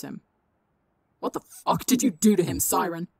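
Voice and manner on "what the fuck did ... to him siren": loud, furious snarl. A narrator's reading. Vigilante grabbing Siren's shirt, a loud furious snarl. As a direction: snarl it right in his face. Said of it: "Nuke snarled".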